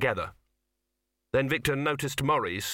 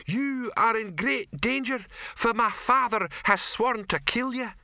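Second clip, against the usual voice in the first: mock heroine; 12 semitones higher, slower than usual. Troll imitates heroine's line.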